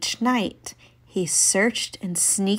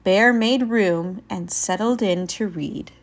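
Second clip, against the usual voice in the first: plain reading voice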